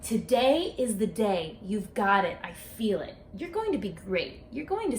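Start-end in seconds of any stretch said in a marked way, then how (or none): none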